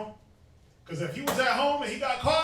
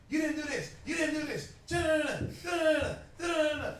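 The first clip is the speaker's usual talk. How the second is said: higher-pitched mock nagging wife rattling on; lightly mocking, higher voice of a scolding wife; 7 semitones higher than usual. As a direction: impersonate the aunt going in on him. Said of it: "and she just going in".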